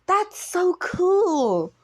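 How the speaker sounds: put-on excited kid, high and loud; Izuku. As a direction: draw it out in high-pitched awe. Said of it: "as Izuku says".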